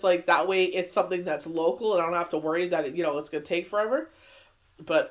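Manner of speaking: ordinary talk, nothing marked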